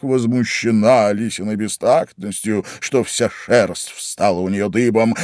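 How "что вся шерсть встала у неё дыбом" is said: ordinary delivery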